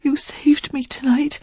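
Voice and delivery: high breathless whisper; whispered thanks. A narrator's reading. Sarah whispering thanks to Derek. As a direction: whisper it close. Sarah grateful after crying.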